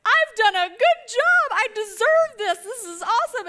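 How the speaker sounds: high, slow, exultant wail; hammed-up triumph. Mom starting her next chapter, hammed-up exultant cry. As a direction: proclaim it with mock tearful pride. Mom's retold emotional release, loud high.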